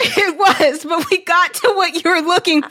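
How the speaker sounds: can't stop laughing as she answers; real giggly laughter. Real, not acted. Cracking up at the weird thread.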